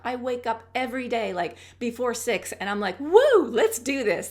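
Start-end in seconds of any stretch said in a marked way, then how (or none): 3.0-4.3 high, loud, drawn-out whoop; excited morning cheer. Retold or imitated. Waking up before six, cheering herself on excitedly. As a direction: cheer yourself up for the day, slowly. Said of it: "It's just so exciting".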